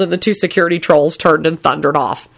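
ordinary delivery, nothing marked

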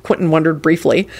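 usual speaking voice

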